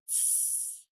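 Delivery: voiceless "تس", just the consonants; isolated TS sound. Shown as a demonstration. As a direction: let "تس" out as a soft voiceless ts. ("تس" من غير صوت)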